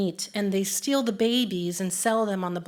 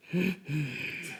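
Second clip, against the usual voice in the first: imitated soft sigh; 4 semitones lower, 8 dB quieter than usual.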